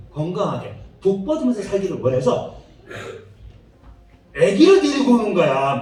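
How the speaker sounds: ordinary talk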